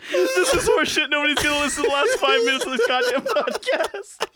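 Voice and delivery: high voice breaking into laughter; can barely speak through tears of laughter; laughing crying. Real, not acted. Cohost collapsing with laughter mid-bit, high and strained.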